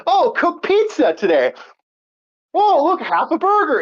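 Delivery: high-pitched exaggerated "ooh, look" voice; put-on excited food spotter; mock delighted discovery. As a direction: exclaim it high, hammed-up. Acting out sampling store food.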